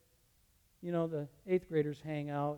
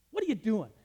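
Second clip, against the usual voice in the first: raised scolding question; stern demand; 6 semitones higher, 9 dB louder than usual. Retold or imitated. Teacher scolding a student at the door, stern raised voice. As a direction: confront the kid sharply.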